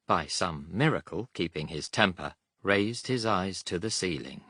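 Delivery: plain reading voice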